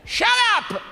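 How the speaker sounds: sudden high yell; father yelling shut up. Retold or imitated. Father on the dock silencing the stoned sons, yelling.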